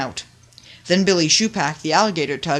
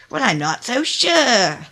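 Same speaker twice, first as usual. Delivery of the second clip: hesitant; 7 semitones higher, 5 dB louder, slower than usual. A narrator's reading. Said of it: "said Little Ditcher".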